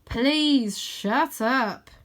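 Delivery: slow, drawn-out, loud plea; long, exasperated groan of words; exasperated request. A narrator's reading. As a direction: drag each word out, fed up. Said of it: "ignoring Rose's frequent request to".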